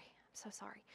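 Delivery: breathy whispered apology, no voice. Real, not acted. Speaker whispers an apology to the conference audience for the fumbled video, whispering. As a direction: whisper the sorry to the room like an aside.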